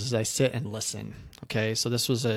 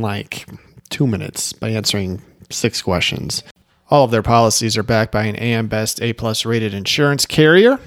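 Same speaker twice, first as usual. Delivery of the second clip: louder, punchy sponsor pitch; ad read; 5 dB louder than usual. Acted. Agent pitching Brightco to agents, upbeat ad read. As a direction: sell it like a sponsor spot.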